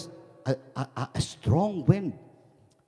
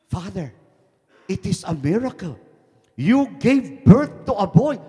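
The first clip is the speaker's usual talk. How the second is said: raised pitch, building to loud; solemn announcement; 7 semitones higher, 8 dB louder than usual. Acted. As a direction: proclaim the miracle, growing louder. Doctor's acted announcement to the priest.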